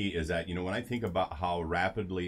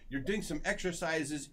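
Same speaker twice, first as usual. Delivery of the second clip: quoted Rudy; 8 semitones higher than usual. Retold or imitated. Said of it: "He goes".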